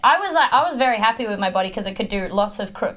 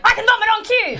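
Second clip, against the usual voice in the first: high mock threat; 11 semitones higher, 4 dB louder, faster than usual. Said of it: "I'd be like".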